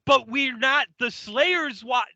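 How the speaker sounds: building from protest to loud, high swearing; angry blow-up. Acted. Watcher giving up on the argument, angry outburst. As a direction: lose your patience halfway through and snap. Quarrel boils over into swearing.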